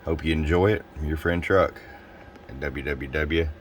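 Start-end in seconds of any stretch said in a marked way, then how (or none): none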